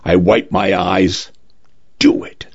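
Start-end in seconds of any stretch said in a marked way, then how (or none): 2.0-2.5 hushed, voiceless resolve; whispered assent. A narrator's reading. Client wiping tears and agreeing to union busting, whispered. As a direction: whisper the go-ahead.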